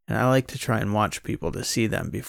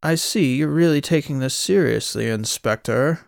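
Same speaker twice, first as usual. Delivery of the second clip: higher, louder mock posh accent; pretentious put-on accent, rising at the end; 4 semitones higher, 4 dB louder than usual. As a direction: imitate her affected fancy accent, pitched up. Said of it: "in that put-on accent".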